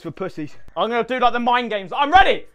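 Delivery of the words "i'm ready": high-pitched, pumped-up insistence; hyped up. Real, not acted. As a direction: pump yourself up for the next round. Hyping himself up between rounds.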